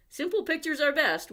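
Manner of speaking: high, loud stern warning. A narrator's reading. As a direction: warn them firmly. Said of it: "warned the photographer".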